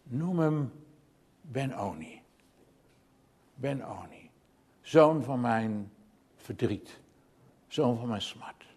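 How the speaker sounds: very soft, low, halting voice; name the child with your last strength, low and slow; imitating a dying woman's faint last words; dying mother. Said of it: "toen ze bijna stierf, riep ze".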